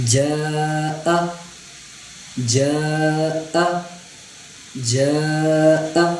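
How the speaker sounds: جاء very slow with a jolted hamza; slow recitation drill. Shown as a demonstration. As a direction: stretch جاء and snap the hamza at the end. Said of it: "جھٹکا دے کر پڑھنا ہے".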